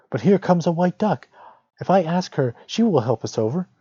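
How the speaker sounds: put-on lightly high, brightening girl; a child spotting help; hopeful girl. A narrator's reading. Gretel spots the duck.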